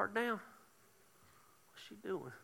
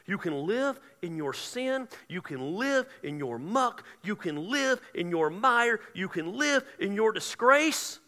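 slow, rhythmic list building in force; preaching refrain; 4 semitones higher, 5 dB louder, slower than usual. Real, not acted. Preacher warns those stuck in guilt, slow and building. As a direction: stretch each phrase, climbing toward the last.

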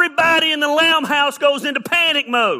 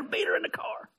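a sly, secret whisper; whispered aside; 8 semitones higher, 11 dB quieter than usual. Retold or imitated. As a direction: whisper it behind your hand. Joking aside to wife.

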